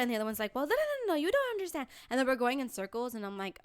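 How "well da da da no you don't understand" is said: high, babbled bickering comeback; mock retort. Other woman arguing back, mock retort. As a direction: fire back in a high mimicking voice.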